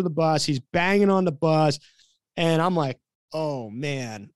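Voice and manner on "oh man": low, soft, sinking retold groan; quiet dismay. Coach dreading the late player's reaction, quiet dismay. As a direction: say it low and under your breath.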